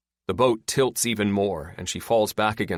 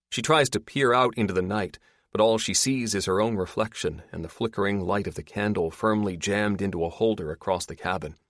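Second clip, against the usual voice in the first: plain reading voice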